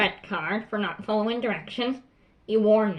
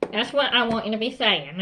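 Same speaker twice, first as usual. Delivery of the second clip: ordinary delivery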